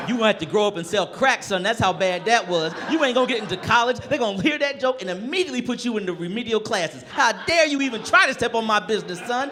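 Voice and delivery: comic scolding a boy for his bad joke, loud mock rage; mock furious roast. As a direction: tear into the kid, over the top.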